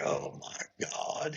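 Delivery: slow, hushed whisper of dismay; retold horror, whispered under the breath; stunned whisper. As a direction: whisper it slowly, as if appalled. Retelling his stunned reaction to the accusation.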